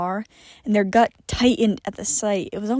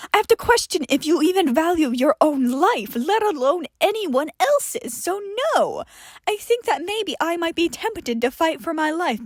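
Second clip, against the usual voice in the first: scornful John voice; 6 semitones higher than usual. Acted. John doubting Johnny deserves control, higher and disdainful. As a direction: lecture him with cold disdain. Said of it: "John scoffed".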